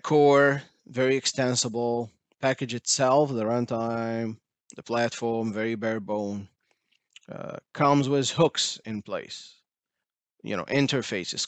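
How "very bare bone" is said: ordinary talk, nothing marked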